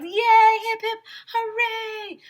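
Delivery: high, loud cheer; joyful chant; crowd cheering. Acted. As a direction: cheer hip hip hooray. Everybody cheering.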